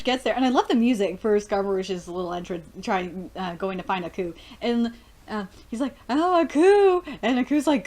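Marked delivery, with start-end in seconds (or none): 6.1-7.0 high, loud, eager greeting; excited calling out. Acted. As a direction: call out to a long-sought friend. Acts out calling to Aku.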